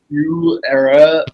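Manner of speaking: "You era" said slowly as reversed "how are you"; backwards speech. Shown as a demonstration. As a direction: drag out "You era" like words played backwards. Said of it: "So how are you would be".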